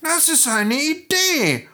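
very high, pleased cat voice; delighted meowing. Acted. Cat welcomes the donkey's idea, meowing with delight. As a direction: mew it out with delight. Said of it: "maunzte die Katze erfreut".

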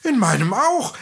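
dwarf chiming in with a rising call; excited dwarf call. A narrator's reading. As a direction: call out eagerly, voice lifting at the end. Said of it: "riefen die Zwerge jetzt durcheinander".

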